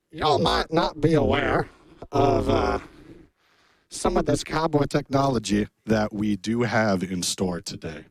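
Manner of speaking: lightly voiced cowboy accent dropping low as if a knob was turned; cowboy accent knob twisted. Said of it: "it turns out that's my accent knob".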